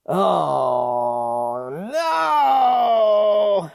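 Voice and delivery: mock dismay. Reacts to knife mayonnaise story.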